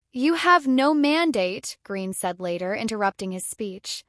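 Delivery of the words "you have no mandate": slow, raised quoted protest; mild heckling. A narrator's reading. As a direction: read it as a measured heckle. Narrator quotes heckler's interruption.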